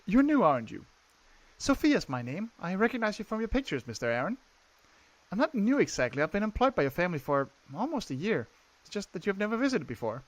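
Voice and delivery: soft-spoken maid introducing herself; maid Sophia. Acted. Voices maid Sophia in dialogue.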